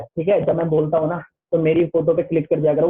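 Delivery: usual speaking voice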